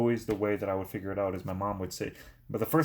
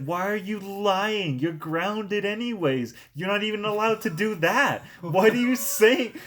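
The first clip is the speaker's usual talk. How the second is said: high, slow, rising furious yell at his son; mock furious dad, climbing higher each line; angry dad yelling; 9 semitones higher, 5 dB louder, slower than usual. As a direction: shout it like an outraged father catching a liar. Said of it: "And he's like".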